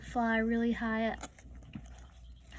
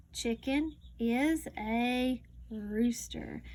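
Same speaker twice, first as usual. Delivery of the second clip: slow, careful read-aloud; reading to a child; much slower than usual. Acted. Teacher reading a farm book to a child, slow read-aloud. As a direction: read each word slowly for a young learner.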